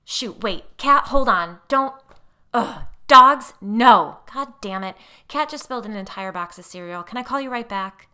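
frantic, loud and rushed; flustered. A narrator's reading. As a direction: scramble as things go wrong. Flustered chaos with dog, cereal.

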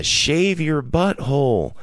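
high mock yell, drawn out; a drunk heckler's high howl. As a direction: bellow it from the crowd, high and slow. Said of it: "I yelled out".

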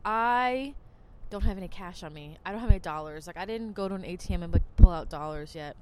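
ordinary talk

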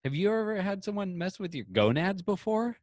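incredulous comeback to the nurse; patient snapping back at the nurse; indignant retort. Retold or imitated.

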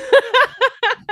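high laugh. Real, not acted. Laughing at friend's jibe.

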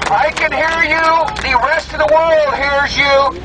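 through a megaphone; rally the crowd through a bullhorn; bullhorn speech. Real, not acted. President with bullhorn at rubble.

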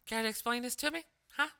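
high mock-angry ex-wife; furious ex-wife. Ex-wife confronting him over the email, mock angry and high. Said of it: "fucking furious".

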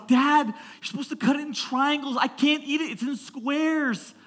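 high-pitched whiny kid imitation; picky child. Daughter objecting to square-cut food, picky child. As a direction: whine at dad like a fussy child.

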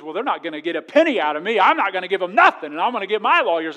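loud, indignant outburst; defiant retort. Retold or imitated. Preacher acting the natural response to being sued, loudly indignant. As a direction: bristle and refuse to pay a penny. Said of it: "you know what our natural response is".

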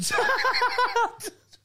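very high, loud burst of laughter; shrieking laugh. Real, not acted. Laughing hard at the punchline to friends.

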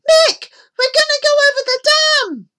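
very high, loud screaming alarm; panicked children's shriek; terrified shout. A narrator's reading. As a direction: scream to a friend for rescue. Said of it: "shouted the children".

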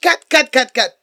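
high, loud frantic director; director yelling cut. Retold or imitated. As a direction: shout cut like an upset director. Coppola stopping the take.

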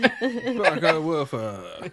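slow, high, giggling barka woofa; laughing dog babble. Acted. Player voices the dog for the table, slow and laughing. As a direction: bark it out while laughing.

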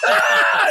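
very high imitated scream; hammed-up shriek of agony; pained scream. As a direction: scream out as if badly stung. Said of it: "and he's screaming".

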